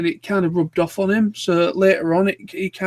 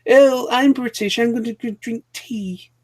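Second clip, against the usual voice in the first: put-on higher-pitched caricature British accent; mock typecast British; 4 semitones higher than usual. Friend mocking typecasting of British people, exaggerated mock British voice. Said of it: "when the typecaster's like".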